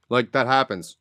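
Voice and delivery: normal talking voice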